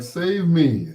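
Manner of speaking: high, small plea; pleading computer. Pretend. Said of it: "It was like".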